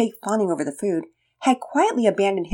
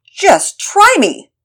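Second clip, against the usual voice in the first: high, slow, loud hero challenge; defiant superhero; 13 semitones higher, 11 dB louder, much slower than usual. Acted. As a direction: fling it back as a defiant hero. Hero answers outlaw.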